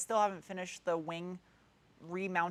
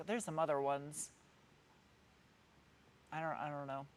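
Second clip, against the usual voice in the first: normal talking voice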